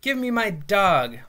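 slow, forceful shout for his dog; retold yell. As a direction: yell at the kid to hand his dog back. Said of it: "I started yelling at the kid".

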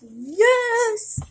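loud, high, drawn-out yes; triumphant cheer. Real, not acted. Celebrating in the game.

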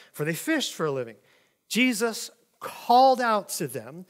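steady, projected Bible reading to the congregation; scripture reading. Acted. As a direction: read the passage out like a preacher. Reads scripture passage aloud.

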